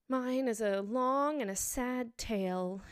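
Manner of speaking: slow, mournful statement; sighing. A narrator's reading. Mouse turning to Alice, sighing. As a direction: draw it out with a sorrowful air. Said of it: "turning to Alice and sighing".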